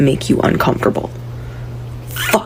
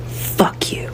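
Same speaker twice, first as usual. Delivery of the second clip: softer, unvoiced hiss; whispered curse; 8 dB quieter than usual. Real, not acted. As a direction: spit it under breath.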